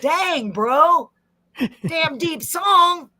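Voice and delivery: high, slow, drawn-out bro exclamation; Ronnie's voice. Acted. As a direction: put on a hyped bro voice. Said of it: "Let me get the voice ready".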